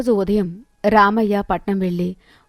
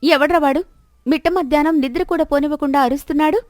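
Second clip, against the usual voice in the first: higher, quick-fire annoyance; cross housewife at the door; irritated; 6 semitones higher, faster than usual. Acted. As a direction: grumble at being woken from a nap. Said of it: "విసుగ్గా బయటకు వచ్చి".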